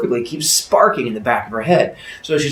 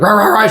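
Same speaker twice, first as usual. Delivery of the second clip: loud, raised roaring bursts; monster growl; 9 semitones higher, 7 dB louder than usual. Acted. Creature in box answers the woman, loud growling. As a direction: growl back in short roars. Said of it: "growly sounds".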